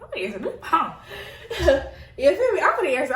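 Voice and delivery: giggly defiance about answering; laughing. Real, not acted. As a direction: crack up mid-line. Laughing about answering her man.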